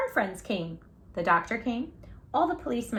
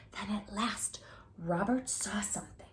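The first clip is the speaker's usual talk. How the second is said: hushed storyteller whisper; suspense whisper; 11 dB quieter than usual. A narrator's reading. Storyteller whispering the big reveal to children.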